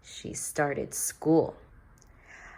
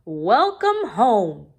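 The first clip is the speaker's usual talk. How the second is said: louder, slow greeting; cheerful father; 4 semitones higher, 8 dB louder, slower than usual. A narrator's reading. Father welcoming daughter home, cheerful. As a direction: greet her at the door. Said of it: "said her father".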